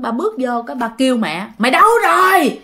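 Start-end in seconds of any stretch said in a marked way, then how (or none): 1.6-2.6 high, loud angry yelling, acted out; quát gọi vợ. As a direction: bellow for the wife like an enraged husband. Acted father yelling for his wife. (giọng chồng quát tháo gọi vợ, cao và to)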